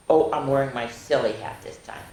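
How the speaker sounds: plain reading voice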